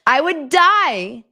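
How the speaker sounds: loud, high, drawn-out wail; horrified exclamation. Acted. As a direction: wail it with exaggerated horror. Appalled reaction to retold quote.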